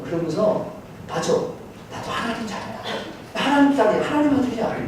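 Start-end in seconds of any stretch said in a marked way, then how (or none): 1.1-4.8 hushed self-affirmation. Retold or imitated. Moved inner realization, voiced softly.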